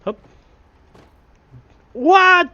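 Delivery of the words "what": high, loud surprised yelp; startled what. Real, not acted. Gamer startled by a barrel shot, high yelp. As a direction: jump at the shot.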